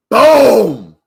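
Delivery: loud, high victory yell; triumphant shout. Real, not acted. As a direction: shout it out like you just won. Trade pays off.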